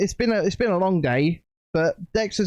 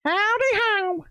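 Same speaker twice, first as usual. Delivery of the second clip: howdy squeaked way up high; squeaky howdy; slower than usual. Pretend. Greeting the stream chat in a squeaky high voice. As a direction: chirp the greeting in a tiny high voice. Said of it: "Howdy, howdy".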